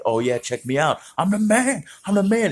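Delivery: high, showing-off boast; cocky self-praise. Retold or imitated. Young him bragging to the crowd, cocky and high. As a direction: strut it, full of himself. Boasting to himself after bike trick.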